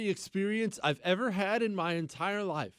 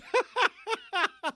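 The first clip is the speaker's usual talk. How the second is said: scoffing laugh; 14 semitones higher than usual. Real, not acted. Laughs at senator's claim.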